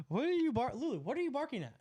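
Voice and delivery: quick, high, anxious question; alarmed at the dog. Real, not acted. Dog barking, checking on her.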